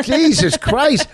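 high, loud, slow exasperated outburst. Real, not acted. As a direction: throw up your hands in disbelief.

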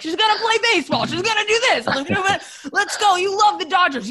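hammed-up high-pitched dad urging a kid on; pushy sports dad. Wife imitating dads who push girls into sports, high mock-pushy. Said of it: "one of those dads who's like".

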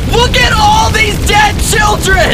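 very high, loud, slow gloating shriek; gleeful fire voice. Acted. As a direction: shriek it like a fire loving destruction. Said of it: "if it's a house fire".

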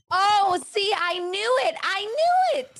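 high, gleeful gotcha; excited. Real, not acted. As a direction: squeal it like you caught him. Gasp at JFK's near-confession.

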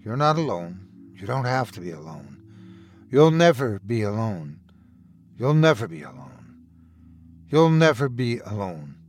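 rock him gently with the words; low soothing reassurance. Acted. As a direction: brother soothing a panicked boy, low and tender. Brother's repeated reassurance, low.

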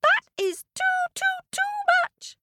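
very high squeak; squeaky ant. Acted. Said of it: "squeaked the ant".

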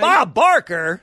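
loud, slow, drawn-out disbelief; incredulous shout. Real, not acted. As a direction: bellow the wrong answer slowly in disbelief. Loudly mocking the wrong answer.

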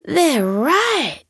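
bright amazed high voice; thrilled discovery; gleaming delight. A narrator's reading. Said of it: "gleaming eyes".

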